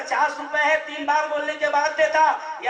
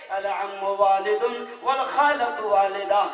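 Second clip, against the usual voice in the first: slow, lower Arabic recitation; 7 semitones lower, slower than usual. Acted. Preacher quoting an Arabic saying to the congregation, slow recitation. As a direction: intone the saying slowly.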